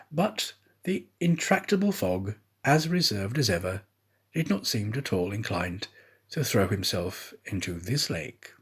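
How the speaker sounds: ordinary delivery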